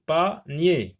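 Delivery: "panier" in two firm beats, pa-nier; split into syllables. Shown as a demonstration. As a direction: sound out "panier" with a break after pa. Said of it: "deux syllabes".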